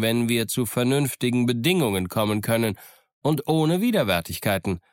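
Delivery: high, animated pirate voice; Long John. A narrator's reading. Pirate explains his captaincy to Smollett, high-voiced. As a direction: answer up in a high, pointed voice. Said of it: "Long John antwortete selber".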